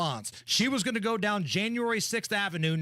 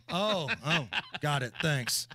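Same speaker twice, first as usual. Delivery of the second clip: ordinary talk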